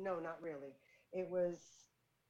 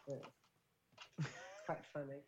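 ordinary delivery

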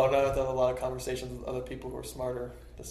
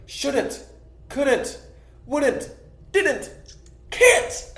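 rising shouts, higher and louder each word; escalating labor-pain yells; woman in labor shouting; 12 semitones higher, 5 dB louder, much slower than usual. Retold or imitated. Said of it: "a woman in labor suddenly shouted".

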